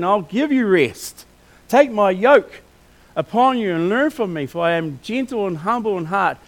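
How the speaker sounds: loud, rousing sermon voice, slowing on key phrases; preaching. Acted. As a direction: urge the congregation on with full force.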